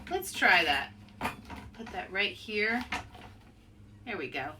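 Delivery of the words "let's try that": usual speaking voice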